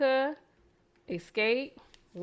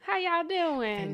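higher, sing-song Southern belle greeting; Southern girl voice; 6 semitones higher, much slower than usual. Shown as a demonstration. Host demos her Southern girl voice for Europe, higher sing-song. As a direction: coo it like a sweet Southern girl charming Europeans. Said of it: "That's my Southern girl voice".

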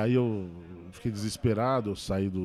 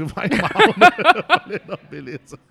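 breaking into laughter mid-quote; higher, louder, cracking up while telling it; laughing; 9 semitones higher, 14 dB louder than usual. Real, not acted. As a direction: tell it through a burst of laughter.

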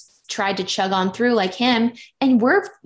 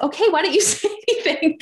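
amused; 9 semitones higher, faster than usual. Retold or imitated. Boyfriend quoted, amused.

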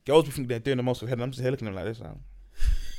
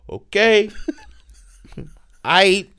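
unimpressed; 9 semitones higher, 8 dB louder, much slower than usual. Retold or imitated. Unimpressed reaction quoted.

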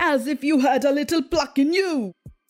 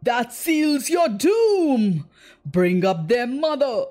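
slow, shouted pirate threat; roaring pirate; slower than usual. Acted. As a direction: bellow the doom at them. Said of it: "Hook roared out".

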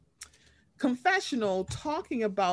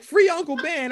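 mock rallying cry; 6 semitones higher, 6 dB louder than usual. Retold chant.

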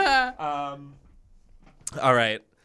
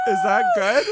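shouting; 6 dB louder than usual. Acted. Mock accent attempt, shouting.

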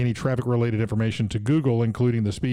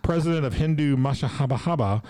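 put-on Indian accent headline; 4 semitones higher than usual. Host reads news headline in a light Indian accent. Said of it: "I don't do an Indian accent".

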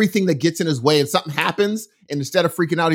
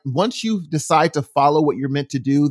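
usual speaking voice

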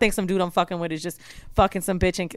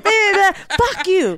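mock angry rant; 9 semitones higher, 4 dB louder than usual. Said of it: "She was like".

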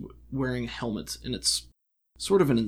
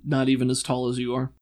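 ordinary talk, nothing marked

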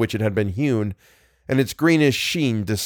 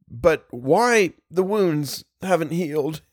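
slow, higher sobbing plea; sobbed; 6 semitones higher, slower than usual. A narrator's reading. Wounded man asks why wounds won't heal, sobbing. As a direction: sob the question out slowly. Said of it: "he sobbed".

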